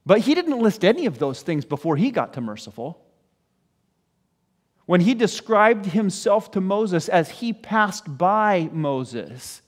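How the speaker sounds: normal talking voice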